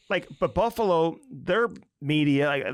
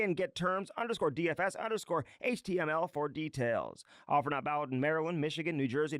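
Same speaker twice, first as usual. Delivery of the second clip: softer, brisk scripted sponsor read; even announcer-style fine print; 3 semitones higher, 7 dB quieter than usual. Real, not acted. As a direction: read promo and disclaimer crisply.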